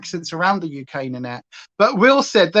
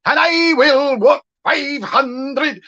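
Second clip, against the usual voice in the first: slow, hammy Scottish singing; exaggerated Scots, drawn out; Scottish accent; 6 semitones higher, 3 dB louder, much slower than usual. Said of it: "putting that on and going".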